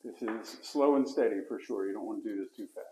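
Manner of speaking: ordinary delivery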